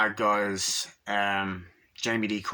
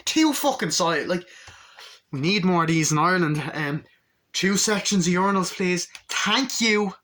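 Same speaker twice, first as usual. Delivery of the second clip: loud, higher, fast demand; excited rant; 7 semitones higher, 5 dB louder than usual. Real, not acted. Asking Ireland for more urinals, excited loud rant. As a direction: pitch it up and push it out like a fan's plea.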